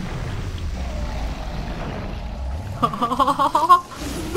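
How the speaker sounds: rapid cackle rising in pitch; gleeful laugh. Real, not acted. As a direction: laugh through the carnage. Laughing during game carnage.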